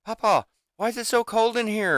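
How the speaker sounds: put-on high child's voice; grandson voice. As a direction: play the little grandson asking. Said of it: "and he's like".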